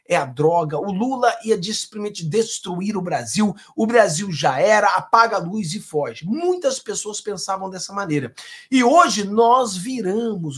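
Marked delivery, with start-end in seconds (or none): none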